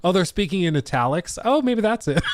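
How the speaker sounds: mock chat voice. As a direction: read the joke comment in a goofy voice. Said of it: "Underrated comment right there".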